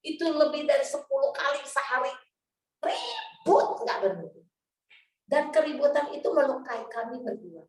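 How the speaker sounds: normal talking voice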